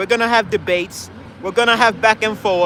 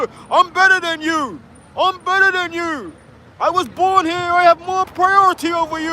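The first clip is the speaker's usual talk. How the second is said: mock entitled brat; 8 semitones higher than usual. Mocking entitled Brits.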